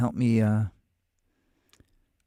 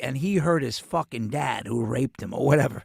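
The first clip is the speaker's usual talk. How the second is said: usual speaking voice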